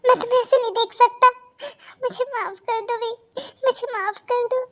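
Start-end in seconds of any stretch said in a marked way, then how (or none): 2.0-4.6 tearful plea. Acted. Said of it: "please मत रो".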